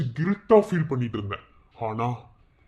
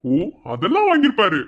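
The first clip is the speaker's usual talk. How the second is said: sarcastic; 7 semitones higher, 6 dB louder than usual. Acted. Sarcastic gloating reply.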